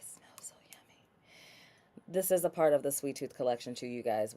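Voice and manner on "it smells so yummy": breathy hush of delight; blissful whisper. Real, not acted. To her viewers about the souffle's smell, whispered in delight. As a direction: whisper it, savouring the scent. Savouring the product's scent.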